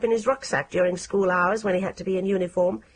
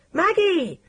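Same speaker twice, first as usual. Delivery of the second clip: shouted name; 10 semitones higher, 3 dB louder than usual. A narrator's reading. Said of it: "shouted someone else".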